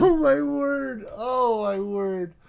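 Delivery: very slow, high oh my word, falling; aghast at what he just heard; drawn-out shocked groan; stunned disbelief. Real, not acted. Reacting to shocking admission.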